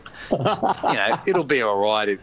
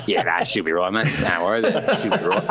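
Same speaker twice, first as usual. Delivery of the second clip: unbothered bloke; 6 semitones lower than usual. Retold or imitated. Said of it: "he's like".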